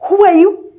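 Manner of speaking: high, loud, sharp challenge from the boss; boss's demand. Retold or imitated. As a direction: bark it out like an offended boss. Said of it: "My boss does this".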